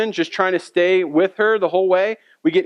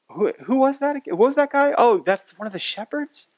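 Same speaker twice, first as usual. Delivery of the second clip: higher-pitched, puzzled double take; amazed onlookers; 5 semitones higher than usual. Acted. Amazed townsfolk asking about the shepherds, puzzled surprise. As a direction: act out baffled onlookers, voice raised. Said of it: "all who heard it were amazed".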